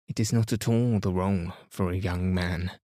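ordinary delivery

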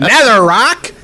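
"Netherrock" with a British "rock" and a rising tune; British accent. Shown as a demonstration. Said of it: "I like my British accent".

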